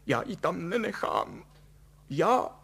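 high, tearful insistence; wailing motherly protest; sobbing. A narrator's reading. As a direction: insist plaintively through tears. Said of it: "prohlásila štkajíc".

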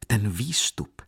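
plain reading voice

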